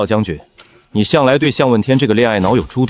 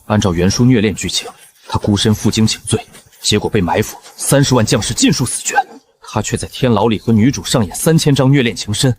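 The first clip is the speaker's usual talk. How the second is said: normal talking voice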